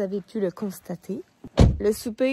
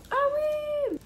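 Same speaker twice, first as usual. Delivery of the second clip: surprised. Real, not acted. Suddenly remembers her order.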